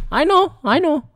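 very high, placating mock voice; retold calm reply. Visitor told to walk around, high mock-calm answer. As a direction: pitch it high, imitating a contained reply. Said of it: "I just calmly said".

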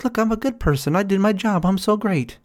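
hammed-up self-congratulation; mock proud people-pleaser. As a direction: puff yourself up, pleased and naive.

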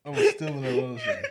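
squealing high through laughter; laughing. Real, not acted.

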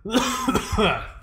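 harsh rasping cough; coughing fit. Real, not acted. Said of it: "I'm choking".

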